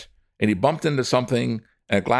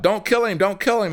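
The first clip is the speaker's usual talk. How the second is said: high urgent plea to stop; calling out; 11 semitones higher than usual. Acted. Voice in the crowd stopping the killing, a high urgent call. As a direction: call out over the crowd to halt them. Someone stops the mob.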